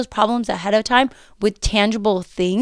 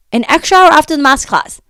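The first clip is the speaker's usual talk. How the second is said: excited emphasis; 6 semitones higher, 7 dB louder than usual. Real, not acted. Stressing extra hour.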